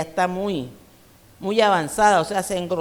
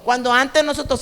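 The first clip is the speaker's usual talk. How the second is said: ordinary delivery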